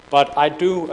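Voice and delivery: ordinary talk, nothing marked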